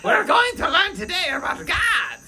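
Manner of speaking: high, loud cartoon villain accent; evil-genius voice teaching a children's sermon; Doofenshmirtz. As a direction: announce it as a hammy evil scientist. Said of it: "He tries to do a Doofenshmirtz accent".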